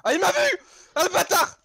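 high, loud startled cry; startled yell. Real, not acted. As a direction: yelp in real alarm. Spotted by the killer.

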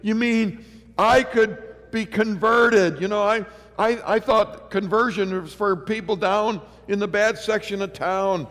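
high, loud sermon climax, slowing on key words; full-force preaching. Acted. Preacher telling the crowd of his conversion, rising to full-force preaching. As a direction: build to a ringing pulpit cry.

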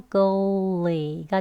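ugly drawn out slowly, syllable by syllable. Shown as a demonstration. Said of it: "소리가 연결되면". (천천히 ugly)

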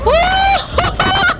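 very high startled "oh" shriek. Real, not acted. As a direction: shriek in sudden surprise. Sudden shock in game.

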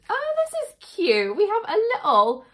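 high, bright squeal of delight over a cute find; excited squeal. Real, not acted. Unboxing a cute sherbet lemon sign, high delighted squeal. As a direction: squeal it up high like you just found treasure.

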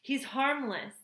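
high, pleading reassurance; begging. A narrator's reading. Robot mother defending her son, begging. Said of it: "begged Roz".

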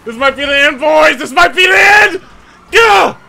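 loud, high shouting that climbs to a scream; escalating panic. Real, not acted. Chase seems about to be lost.

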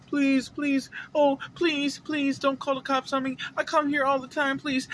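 high whiny mock begging; pleading customer. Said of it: "he's going".

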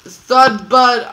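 very loud and high, delighted recognition; excited exclamation. Real, not acted. Player reminded of Thud Bud by friend, excited exclamation. Excitedly recalls an old name.

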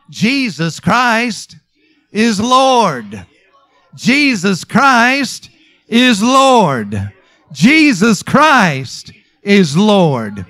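slow, loud, ringing proclamation; drawn-out emphatic declaration; preaching at full force. Real, not acted. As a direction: proclaim it word by word to the congregation.

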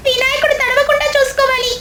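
put-on high, bright-eyed little kid voice; child voice. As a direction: speak like an eager small child. Character during windy rain. (చిన్న పిల్లాడి గొంతు)

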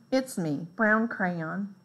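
ordinary delivery

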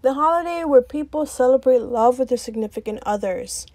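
loud, mock-defiant intro rant. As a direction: shout it out to society, cheeky and bold.

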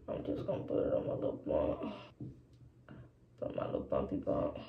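breathy whisper to herself while dabbing primer; hushed self-talk while working; whispered aside. Real, not acted. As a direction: murmur it under your breath like a private note.

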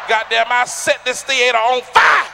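slow shouted boast; loud and slow. Real, not acted. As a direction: holler it slowly. Excited joke, shouted.

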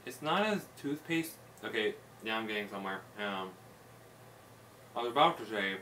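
talking between bites; chewing while talking. Real, not acted. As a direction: mouth partly full. Seen 2: holds dark cookie, bites repeatedly, mouth working between words.

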